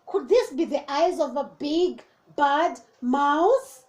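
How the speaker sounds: ordinary delivery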